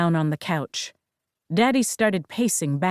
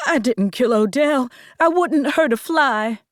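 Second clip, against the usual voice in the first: outraged denial, higher and louder; accused wife exploding at the sheriff; 5 semitones higher, 4 dB louder than usual. Acted. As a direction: burst out defending yourself. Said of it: "I exploded".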